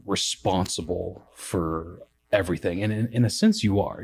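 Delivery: normal talking voice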